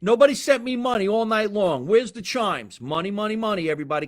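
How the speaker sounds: loud, high, thrilled call for money; excited shouting. Real, not acted. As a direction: cheer the viewers on to ring the bell. Excited about money coming in.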